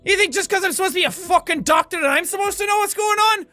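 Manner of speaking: loud, fast, high-pitched indignant tirade; exasperated outburst at full tilt; out-of-character rant. Acted. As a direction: drop the accent and rant rapidly. Drops the doctor act, ranting.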